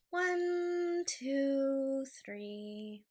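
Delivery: slow, drawn-out breathing count; meditation count. Real, not acted. Vlogger leading listeners through a calming breath, slow meditative count. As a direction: count each number long, settling downward.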